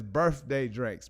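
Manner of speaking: "birthday" said with f for th, slowly; birfday dranks. Shown as a demonstration. Host reading out the misspelled thumbnail, birfday dranks. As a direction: say "birthday drinks" slowly as "burf-day dranks". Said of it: "B R R F day drinks".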